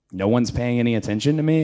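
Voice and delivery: ordinary talk, nothing marked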